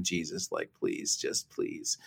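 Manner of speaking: breathy whisper, slowing right down; desperate whispered prayer. Retold or imitated. Alone in his office, too worn out to pray: slow, whispered plea. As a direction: plead almost without voice, drawn out.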